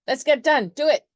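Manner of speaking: loud, high hype-up shout; punchy, shouted pep talk; pushy Aries. Acted. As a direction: egg a friend on at full volume. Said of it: "And Aries is like".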